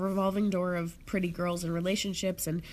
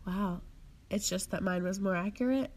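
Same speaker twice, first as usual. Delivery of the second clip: ordinary talk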